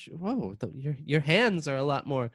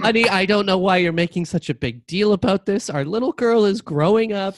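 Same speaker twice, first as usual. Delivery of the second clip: loud; 5 semitones higher, 7 dB louder than usual. Acted. Mother insists loudly.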